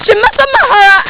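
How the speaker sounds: mock wail. Hammed-up outrage over hair.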